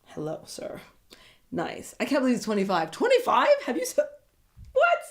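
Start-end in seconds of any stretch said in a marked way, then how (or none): 0.1-1.9 unvoiced admiring whisper; hushed flirty whisper; breathy whisper. Real, not acted. Admiring a handsome contestant. 2.9-5.0 high squeaky disbelief; stunned high-pitched disbelief; shocked. Real, not acted. Shocked at his age.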